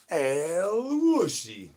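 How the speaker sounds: very slow and high, each syllable stretched long; drawn-out El Wushy call. Pretend. Streamer performing the El Wushy call chat asked for, slow and high. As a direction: croon the name out slowly like a summons. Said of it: "I guess I'll do it".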